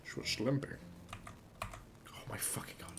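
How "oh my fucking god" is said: whispered oh my fucking god; shocked whisper. Real, not acted. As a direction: breathe it out in disbelief. Shocked at the game.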